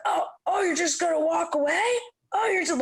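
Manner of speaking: high, indignant mock outrage; offended drunk. Drunk man offended she walks away from him. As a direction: play the drunk acting wounded and mad. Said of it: "was mad at me".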